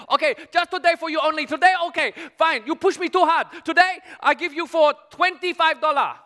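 high, put-upon market seller; hammed-up haggler making an offer; bargaining shopkeeper. Seller voiced making a special offer.

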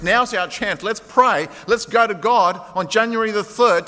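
normal talking voice

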